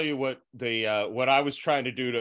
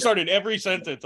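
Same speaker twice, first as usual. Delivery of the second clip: usual speaking voice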